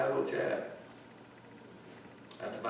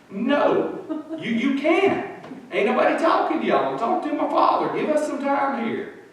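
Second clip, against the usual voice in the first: raised, high-pitched, loud dismissal; indignant rebuff; 14 semitones higher, 7 dB louder than usual. Retold or imitated. Praying woman tells hospital staff to leave her be, indignant rebuff. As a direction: send the intruders away mid-prayer.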